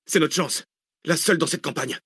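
high, urgent exclamation; crying out. A narrator's reading. Driver seizing the only chance to escape, crying out. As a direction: call it out as the one hope. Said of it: "hurla Zach".

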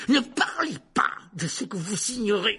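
high, loud, frenzied scream; demented fury. A narrator's reading. Teacher gone wild at student, frenzied shout. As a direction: explode in a high-pitched rage. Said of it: "soudain l'air d'un démon".

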